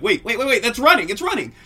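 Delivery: high, loud panicked retelling; acted alarm. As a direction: blurt it in rising alarm. Acts out couple's alarm at noise.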